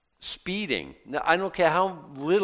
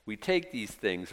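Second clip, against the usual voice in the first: ordinary talk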